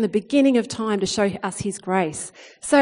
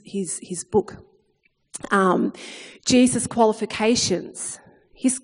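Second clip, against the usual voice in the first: ordinary delivery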